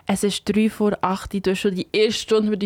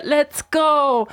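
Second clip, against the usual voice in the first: high, drawn-out "Let's go!"; excited radio host's hype call; hyped call; 7 semitones higher, 4 dB louder than usual. Real, not acted. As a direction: launch the next track with an excited cheer. Hyped host moving on to the music.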